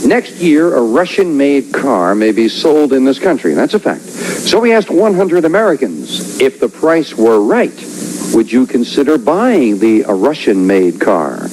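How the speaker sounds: announcer reading the survey question; host voice posing the question; game show host. Acted.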